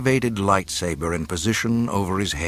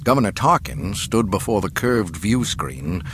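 ordinary delivery, nothing marked

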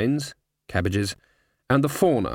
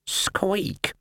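squeak; 8 semitones higher than usual. Acted. Rat answers.

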